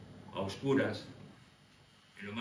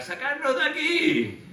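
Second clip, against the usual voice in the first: higher, louder, slow yell for help; mock shout; 13 semitones higher, 6 dB louder, slower than usual. Paul and Silas imagined yelling from their cell, hammed-up shout. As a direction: bellow it as a desperate prisoner. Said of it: "Se ponen a gritar".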